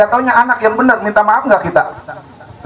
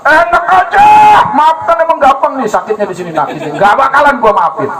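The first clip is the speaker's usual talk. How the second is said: grudging outburst; 4 semitones higher, 3 dB louder than usual. Retold or imitated. Grudge after visitor leaves.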